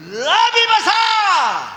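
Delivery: slow, high, loud preacher's cry; triumphant drawn-out shout; emphatic proclamation. Real, not acted. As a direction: stretch it out at full force. Preacher proclaims scripture's key words.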